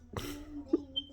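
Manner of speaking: quiet breathy giggle; soft laugh. Real, not acted. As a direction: laugh under your breath. Laughing at pregnancy-test look.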